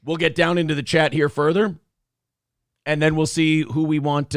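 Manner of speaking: normal talking voice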